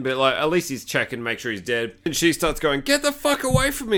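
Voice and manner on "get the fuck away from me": high-pitched imitation of a panicked woman; mock panic. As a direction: play at fending someone off in fright. Woman screams at hunter.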